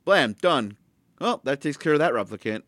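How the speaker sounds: offhand, breezy dismissal; mock casual kill. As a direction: toss it off like nothing happened.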